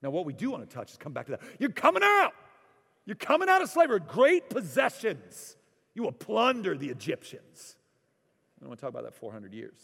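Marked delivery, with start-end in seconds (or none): 1.6-7.8 high, loud triumphant preacher cry; preaching at full force. Acted. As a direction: proclaim it like a revival shout. Preaching climax of Exodus promise.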